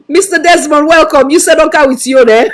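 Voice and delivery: a real laughing welcome; laughing greeting. Real, not acted. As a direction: greet him while still laughing. Greeting guest while laughing.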